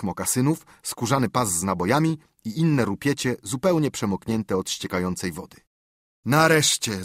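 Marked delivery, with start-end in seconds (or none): 6.2-7.0 high, louder cry of relief; a glad higher shout; exclamation. Acted. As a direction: call it out in triumph. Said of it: "zawołał Hak".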